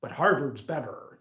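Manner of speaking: imitating his status-minded parents; snobby parents. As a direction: quiz him like pushy parents. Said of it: "it was always like".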